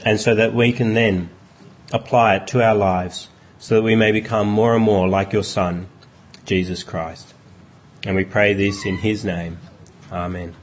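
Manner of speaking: soft, low prayer voice winding down; closing prayer. Acted. Pastor closing a prayer in Jesus' name, quiet and reverent. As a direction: pray it quietly to the close.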